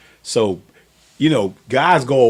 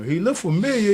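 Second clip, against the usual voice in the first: quote; 10 semitones higher than usual. Retold or imitated. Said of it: "they were like".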